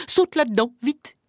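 hurried, alarmed command; urgent warning. Acted. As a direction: order someone to hide at once. Wife urgently hides Jack from ogre.

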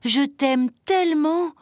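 high, slow, heartfelt chick voice; adoring child to papa; loving little chick. A narrator's reading. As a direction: draw it out high with love. Chick tells father he loves him.

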